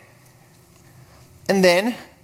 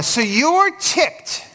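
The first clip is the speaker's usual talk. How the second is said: a drawn-out, higher, incredulous jab; 9 semitones higher, much slower than usual. Retold or imitated. God mocking Jonah's anger at losing the plant, incredulous. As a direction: pitch jumps higher, drawn out slowly.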